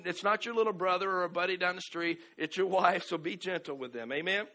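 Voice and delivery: ordinary talk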